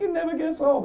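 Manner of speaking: high, flat nurse voice; lightly voiced nurse; nurse quoted. Nurse answering the comic, pitched high. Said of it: "She's like".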